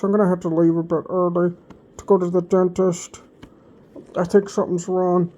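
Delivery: words mumbled as if through a swollen cheek; swollen-cheek speech. Retold or imitated. Quoting himself telling his boss he must leave, mumbled swollen-cheek speech. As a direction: talk like the jaw is puffed up and hurts. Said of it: "talking like this the whole time".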